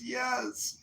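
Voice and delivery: high-pitched, small acted reply; imitated tearful mother. Mother answers the first sergeant, mock tearful. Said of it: "there's a woman crying in the stands".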